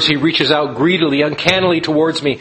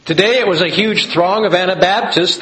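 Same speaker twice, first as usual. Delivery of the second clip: laughing; 6 semitones higher, 4 dB louder than usual. Acted. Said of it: "laugh heard".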